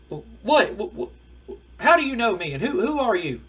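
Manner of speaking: loud, high, stammering imitation of disbelief; flustered bafflement. Manager confronts a crying stranger, flustered bafflement. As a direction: splutter as if facing a total stranger.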